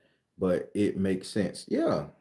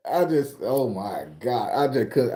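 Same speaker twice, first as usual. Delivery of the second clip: ordinary talk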